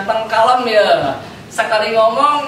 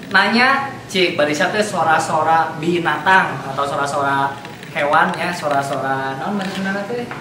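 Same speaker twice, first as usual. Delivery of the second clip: ordinary delivery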